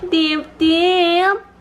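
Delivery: high cooing call; sing-song pet name. Real, not acted. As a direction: call the dog sweetly. Calling the dog by pet name.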